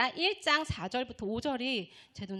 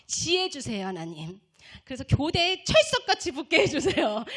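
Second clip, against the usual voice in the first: fasting prayer; 3 semitones higher, 7 dB louder than usual. Retold or imitated. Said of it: "금식 기도 삼 일을 하면서".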